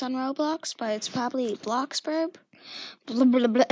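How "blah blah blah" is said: loud mock babble. Mocking her own rambling.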